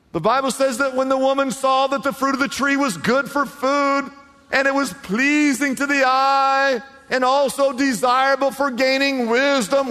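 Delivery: high, loud preacher's build; fiery preaching. Acted. Pastor preaching the fall to the congregation, full force. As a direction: drive the story home from the pulpit.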